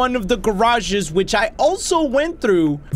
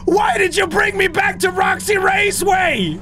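outraged disbelief; 11 semitones higher than usual. Real, not acted. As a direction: yell at the game in disbelief. Game sent him back.

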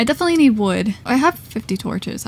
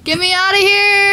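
high, loud cry to escape; panicked shout; 8 semitones higher, 6 dB louder than usual. Real, not acted. Player fleeing zombies in the game, panicked shouting.

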